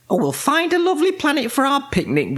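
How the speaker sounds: high, quick character voice; cheery witch. A narrator's reading. Winnie to Wilbur about the picnic, high cheery witch voice. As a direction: pitch it high and quick, reassuring the cat.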